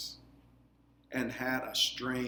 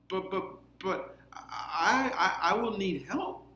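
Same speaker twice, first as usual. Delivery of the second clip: higher, stammering plea; pleading boy; 7 semitones higher than usual. A narrator's reading. Lucas pleading with the queen, stammering and higher. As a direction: beg for help, tripping over the words. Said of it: "Lucas pleaded".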